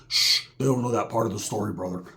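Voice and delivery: low and soft, shushing; hushed aside. Retold or imitated. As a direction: hush it like a secret. Roach hushing.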